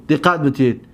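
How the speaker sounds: usual speaking voice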